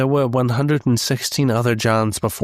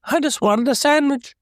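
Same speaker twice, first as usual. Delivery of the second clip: much higher twangy unsure country voice; Shaggy voice; 11 semitones higher than usual. Pretend. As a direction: whine it like a clueless stoner. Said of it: "Shaggy has a little bit of twang".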